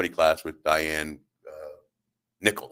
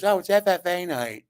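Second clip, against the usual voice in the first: high-pitched chirpy answer; squeaky small-man impression; little man's voice; 12 semitones higher than usual. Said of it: "And he goes".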